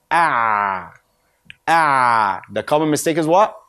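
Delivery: ordinary talk, nothing marked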